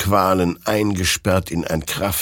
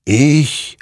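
higher, louder, drawn-out word; murmuring creature; 8 semitones higher, 6 dB louder than usual. A narrator's reading. Creature answers who won, ominous murmur. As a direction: say it as the otherworldly victor. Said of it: "murmelte dieser".

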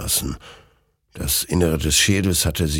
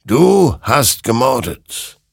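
slow, loud, raised accusation; accusing; 6 semitones higher, 5 dB louder, slower than usual. A narrator's reading. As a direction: pronounce the charge heavily, word by word. Creature accuses Hector again.

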